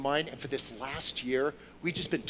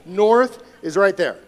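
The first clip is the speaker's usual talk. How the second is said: much louder, slow; loud correction; 3 semitones higher, 13 dB louder, slower than usual. Real, not acted. Correcting the congregation's wrong pointing, loudly. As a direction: call it out over the room.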